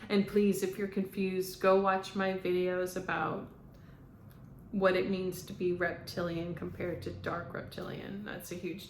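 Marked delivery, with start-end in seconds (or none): none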